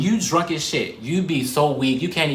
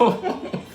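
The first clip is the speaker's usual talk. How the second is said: breathy wheeze laugh. Real, not acted. Laugh after exclamation.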